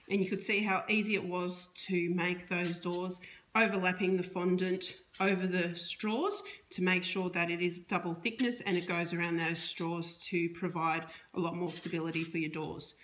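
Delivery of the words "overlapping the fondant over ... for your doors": normal talking voice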